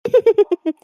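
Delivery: loud smug cackle; smug laugh. Acted. Mother-in-law laughing after fooling the daughter-in-law, smug cackle. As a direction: laugh at your own trick. Laughs at her own act.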